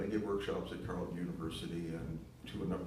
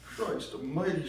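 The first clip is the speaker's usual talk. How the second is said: sobbing; 8 semitones higher, 6 dB louder, slower than usual. Acted. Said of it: "she sobbed".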